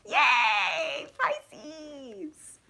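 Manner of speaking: high, drawn-out celebratory cheer; excited cheer. Real, not acted. Reader greeting the last sign, excited high cheer. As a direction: whoop it out long and high.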